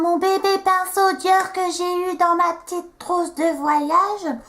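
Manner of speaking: high, sustained sing-song cutesy voice; cutesy baby-talk. Pretend. Vlogger showing off her baby Dior brush, cutesy high sing-song. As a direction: coo it high, stretching the last words.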